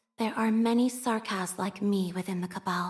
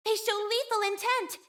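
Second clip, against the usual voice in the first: much higher urgent alert; alarmed warning; 11 semitones higher than usual. Acted. Operator warning the squad of enemies, alarmed. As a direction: warn the squad of danger.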